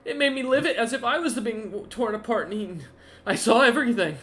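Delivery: high, loud, hurried accusation; distraught outburst. Acted. Game master voices creature reliving its kills, distraught. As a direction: pour out the horror, high and loud.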